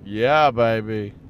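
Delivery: drawn-out catchphrase in a light spy-spoof impression; Austin Powers. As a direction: stretch it slowly, lightly playing the swinging spy. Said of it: "he has a British accent in fucking Austin Powers".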